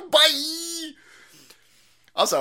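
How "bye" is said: high, stretched mock farewell; mocking imitation. Host laughing at the clip's sign-off, mocking. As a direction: parody a drawn-out cutesy bye. Mimics the clip's bye again.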